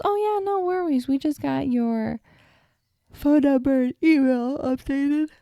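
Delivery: high, bright customer-service voice, mildly imitated; chirpy clerk. Clerk reassuring her at the counter, chirpy. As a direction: chirp it like a cheerful cashier. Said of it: "And she goes".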